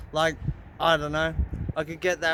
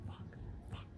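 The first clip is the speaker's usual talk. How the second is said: whispered fuck under the breath; whispered curse; 24 dB quieter than usual. Real, not acted. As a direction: hiss it almost silently. Whispered curse after angry rant.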